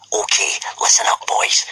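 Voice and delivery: whispered threat; menacing whisper. Acted. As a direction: hush them with a threat. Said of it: "he whispered in a menacing fashion".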